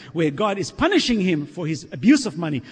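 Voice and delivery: usual speaking voice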